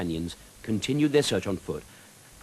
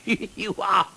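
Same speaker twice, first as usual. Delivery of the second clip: hooting with laughter; 9 semitones higher than usual. Acted. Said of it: "hooted Sir Tumbleweed".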